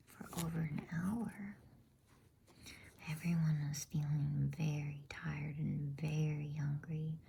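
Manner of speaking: plain reading voice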